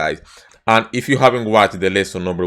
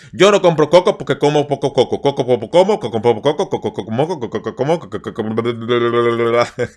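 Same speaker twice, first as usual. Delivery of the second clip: rushed, dissolving into bla bla; fast tongue twister falling apart; faster than usual. Shown as a demonstration. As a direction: lose the thread mid-twister. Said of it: "It's very hard".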